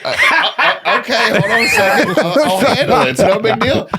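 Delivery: flustered laughing reassurance. Retold or imitated. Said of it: "And I was like".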